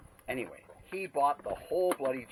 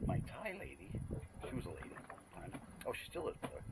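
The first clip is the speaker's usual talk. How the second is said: normal talking voice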